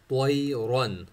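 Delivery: त्वयरन with a thick त्वा and a heavy रा; heavy letters. Shown as a demonstration. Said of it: "त्वा और रा दोनों को मोटा पढ़ेंगे".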